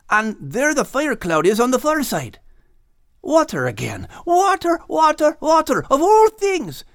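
lightly voiced Scottish lad, earnest; Max praying. A narrator's reading. Max alone on the beach praying to the Maker, earnest. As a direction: pour your heart out to the Maker.

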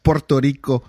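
mock Spanish-accented "Puerto Rico". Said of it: "Like you have to say it like that".

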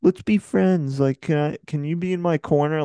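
mock-pleading, needy tone; pleading Tom impression. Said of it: "He was just like".

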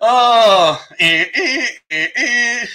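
high cackle then a hammed-up fake sob; mock crying over the Spurs fans; mock gloating wail. Host gloats at Spurs fans with a mock wail.

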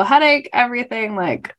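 normal talking voice

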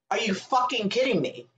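ordinary delivery

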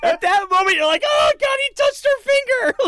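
very high, loud squeal of shock; thrilled exclamation. Retold or imitated. Film fan acting out the audience's reaction to the touch, thrilled. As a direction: shriek it like a stunned fan.

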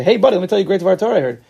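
eager guy pushing a Dvar Torah on a friend; pushy friend. Retold or imitated. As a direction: rush him into hearing it like an eager buddy. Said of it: "You say".

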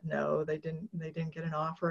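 usual speaking voice

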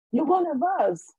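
a breathless, wondering announcement; awed revelation. Retold or imitated. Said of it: "she went".

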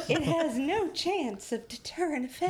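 high-pitched mock editorial voice, laughter creeping in; put-on Times voice. Host continuing the Times quote through giggles, put-on editorial voice. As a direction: declaim it in a hammed-up newspaper voice. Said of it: "You changed your voice".

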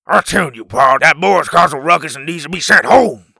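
loud, rough caveman demand; angry caveman. A narrator's reading. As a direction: demand it furiously.

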